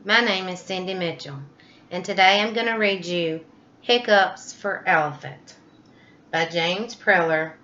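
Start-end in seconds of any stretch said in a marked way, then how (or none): none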